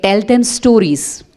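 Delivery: "Tell them stories" drawn out slowly, stress on them; slow, deliberate quoted wisdom; slow weighty answer. Retold or imitated. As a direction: deliver it very slow, like a sage. Einstein's reply, slow.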